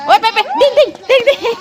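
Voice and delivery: high-pitched beeps and dings breaking into laughter; playful beeping. Pretend. Amusing little Ray as Papa leaves, playful beeping. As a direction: squeak out toy sounds for a toddler. Playful noises to amuse toddler.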